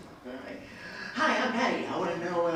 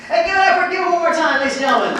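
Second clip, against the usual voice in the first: much louder, higher crowd hype; hype announcer; 13 semitones higher, 14 dB louder, faster than usual. Acted. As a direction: pump up the audience. Host hypes the crowd.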